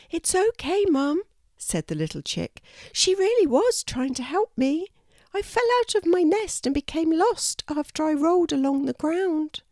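high, small bird voice; a lost little chick explaining herself; kookaburra chick voice. A narrator's reading. Baby kookaburra telling the kangaroo how she fell, small high voice.

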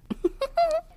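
quick high-pitched giggle. Real, not acted. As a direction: burst into a short high laugh. Laughs after reading.

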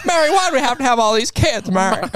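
high, pleading film star impression; Jimmy Stewart. Husband questioning his wife about all their kids, high-pitched impression. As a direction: whine it high like an old movie husband. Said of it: "this is Rachel's favorite impression I do".

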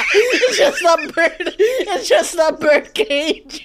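loud, slow laugh-through words; laughing hard. Real, not acted.

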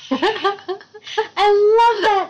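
laughing through the words. Real, not acted. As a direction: giggle it out. Laughing while talking.

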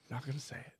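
unvoiced whisper to himself. Real, not acted. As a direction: whisper it as an aside. Whispered aside.

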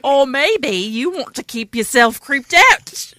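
slow Australian twang, a bit loud; Australian accent. Pretend. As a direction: drawl it like an Aussie. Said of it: "That's a little Australian".

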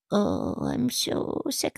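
exaggerated fake-ill whimper; fake sick kid. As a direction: play the sick child to stay home. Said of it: "I'd be like".

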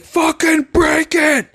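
high, drawn-out yelled command; hammed-up urging yell; urgent mock shout. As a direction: egg him on like a shouting coach. Dream voice urging him to kick.

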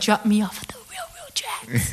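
high, whispery spooky squeal; mock spooky voice. Passenger to Uber driver in a spooky video, mock-creepy whisper. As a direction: put on a playful, eerie high whisper.

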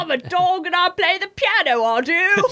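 high-pitched comic British character; mock British accent. Co-host playing Rolf the dog, mock British accent. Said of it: "I got to do a British accent".

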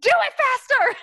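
high, loud mock-frantic command; bossy shout. Friend hurrying the other before the call, bossy shout. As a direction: yell it like a squabbling sibling. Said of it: "siblings who fight all the time".

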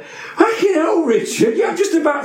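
half-laughing alarm at the news; telling his friend off in laughing disbelief; laughing panic. Retold or imitated. Retold panic before going on.

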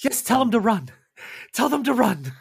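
high, loud, excited taunt; gleeful predator. Acted. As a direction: egg him on, relishing the hunt. Villain relishes the coming chase.

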